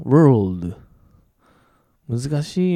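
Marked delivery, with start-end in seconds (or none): 0.0-0.7 loud "world" for learners to copy; model pronunciation. Shown as a demonstration. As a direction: punch out "world" with curled r, l, then d. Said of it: "自分で発音してごらん".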